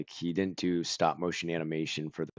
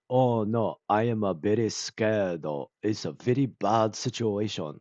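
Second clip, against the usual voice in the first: put-on heavy Japanese-accented English, louder at the start; imitated Japanese accent; 3 semitones higher, 4 dB louder than usual. Movie fan showing an actor's forced katakana English, imitated Japanese accent. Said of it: "imagine someone doing an impression of a Japanese person".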